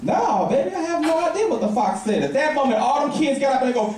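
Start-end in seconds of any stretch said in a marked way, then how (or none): none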